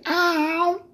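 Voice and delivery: squeaky high hey ow; high screechy phone voice. Retold or imitated. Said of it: "That's what you say".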